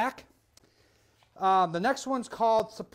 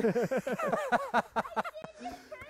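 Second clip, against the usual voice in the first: high squeaky laugh. Real, not acted. Laugh after praise.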